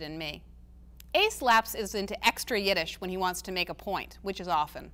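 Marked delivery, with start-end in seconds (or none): none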